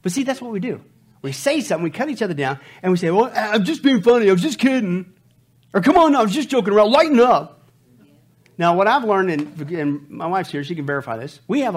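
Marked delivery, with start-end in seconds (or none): 3.1-5.1 defensive excuse. Retold or imitated. Mocking excuse after cutting down. 5.8-7.7 louder, higher brush-off; loud mock protest. Mocking excuse after cutting down.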